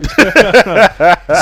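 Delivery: real loud laughter. Real, not acted. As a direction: burst out laughing. Laughing at the boss's story.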